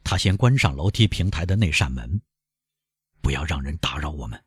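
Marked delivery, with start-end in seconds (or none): 3.2-4.4 low, soft, private instruction to himself; hushed resolve. A narrator's reading. Villefort shuts the landing door, hushed and resolved. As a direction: keep it quiet and controlled.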